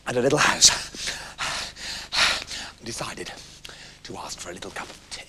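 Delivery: low, soft words between heavy pants; exhausted, acted panting; out of breath. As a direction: gasp it out after a very long run. Animals exhausted after running far.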